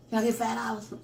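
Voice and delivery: lightly voiced kid begging for money; a hint of a whiny child; mock kid asking. Mom mimicking her kids asking for money, light mock child voice. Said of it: "They be asking me dumb stuff".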